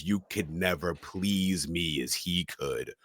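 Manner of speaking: low, soft and slow cutting taunt; lightly voiced seductive scorn, slow; slow, quiet, low taunting line. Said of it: "slowly looks up in his intense stare and says".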